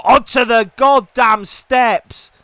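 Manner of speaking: high, loud, slow outcry; shocked shout. Real, not acted. Commentator seeing a powerbomb on steps, shocked shout. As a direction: cry out at a brutal landing.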